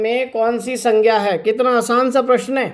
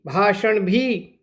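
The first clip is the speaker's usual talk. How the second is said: usual speaking voice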